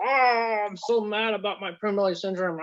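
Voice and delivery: hammed-up bitter complaint, pitched high; mock spiteful whine. Imagining himself complaining to God, mock spiteful whine. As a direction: whine it like a sulky vessel. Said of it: "the spiteful, hateful".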